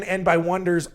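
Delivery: ordinary talk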